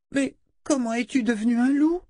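put-on very high, wondering child's voice; little girl. A narrator's reading. Girl questions the talking wolf, high wondering child voice. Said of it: "La fillette".

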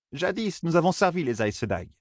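high, soft matriarch voice; high character voice starting softly; elder recalling. Acted. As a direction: recall old times as an elder, pitch lifted. Elder recalls the past in dialogue.

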